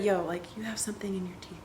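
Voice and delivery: hushed, quick mock whisper; discreet whisper. Someone tipping you off about your teeth, discreet whisper.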